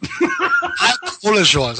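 slow, with a laugh through the words; laughing apology. Real, not acted. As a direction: apologize while laughing at yourself. Laughing apology after being corrected.

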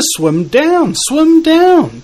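high, loud urgent call; rising to an excited shout. Retold or imitated. Fish call each other to dive.

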